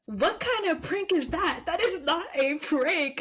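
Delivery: high-pitched incredulous outburst; real exasperated disbelief; indignant disbelief. Real, not acted. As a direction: react appalled, pitch swooping up. Indignant reaction to the prank story.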